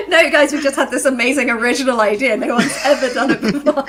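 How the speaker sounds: loud, laughing mock boast; laughing sarcasm. Telling friends their idea is taken, laughing mock pride. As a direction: gloat through laughter about a stolen idea.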